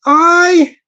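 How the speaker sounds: high, held calling note. Shown as a demonstration. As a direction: pitch the pretend shout up high. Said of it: "my voice has gone higher".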